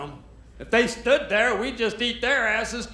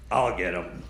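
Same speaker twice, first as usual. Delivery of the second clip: low, gruff-sounding hunter mutter; much lower hunter voice. Retold or imitated. Hunter eyeing a parrot, much lower voice. Hunter spotting a pretty bird.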